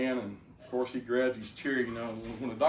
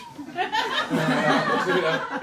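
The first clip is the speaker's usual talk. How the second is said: a big, high burst of laughter; loud high laugh; 8 dB louder than usual. Real, not acted. Telling the crowd about the heart pump, a loud high laugh. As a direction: laugh out loud at your own joke. Laughs at his own joke.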